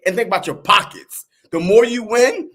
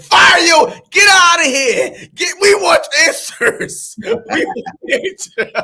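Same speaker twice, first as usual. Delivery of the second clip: much higher, louder mock fan outrage; mock angry fan shout; 8 semitones higher, 5 dB louder, slower than usual. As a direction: yell like a fed-up fan, slow and hammed-up. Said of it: "it was like".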